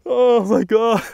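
high, loud burst of amazement; excited shout. Real, not acted. Angler sees the monster fish, shouting in amazement. Shock at the fish's size.

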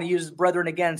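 usual speaking voice

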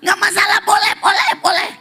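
high, loud eager cry of boleh; excited shout. Retold or imitated. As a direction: burst out reassuring her, loud and high. Eager reassurance to the veiled wife.

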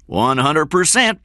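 put-on high Southern-accented agreement; high-pitched thick Southern voice. A narrator's reading. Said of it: "in a thick Southern accent".

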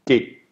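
G sound demo; soft G. Shown as a demonstration. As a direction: say "g" short and unaspirated. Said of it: "G is pronounced g".

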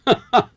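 loud, high burst of laughter; dismissive laugh. Acted. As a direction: laugh the idea away. Boss laughs off her suspicion. (lautes, abwinkendes Lachen)

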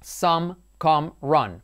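some, come, run with a short open "o"-like vowel; short u demo. Shown as a demonstration. Teacher modelling the hung vowel with example words. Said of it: "se pronuncia como some".

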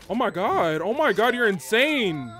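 loud, high hype shout; amazed outburst. Real, not acted. Gamer hyping a teammate's insane play, amazed shout.

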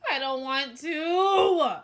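slow, high, loud whine; drawn-out pleading whine; whining. Real, not acted. Dreading the documentary.

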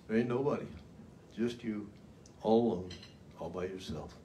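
trailing off alone and quiet; lonely inner voice. Acted. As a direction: let it sink into a lonely hush. Voicing the lonely feeling.

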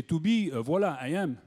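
mock French accent reciting Shakespeare; French accent with a hesitant 'uh'. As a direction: play the Bard as a Frenchman. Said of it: "why can't there be a French Shakespeare?".

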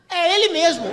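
mock amazed cry; astonished recognition. Old woman sees his unmasked face.

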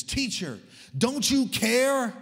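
high, loud, drawn-out cry of the disciples; shouted protest. Retold or imitated. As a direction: shout it as an offended plea. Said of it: "they shouted at Jesus".

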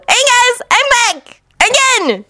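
put-on very high-pitched, bounding young ninja; bouncy Naruto. A narrator's reading. Naruto bounds up to announce his return, very high bouncy voice. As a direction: pipe up high as the bouncy interrupter. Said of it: "Naruto informed them, bounding up to them".

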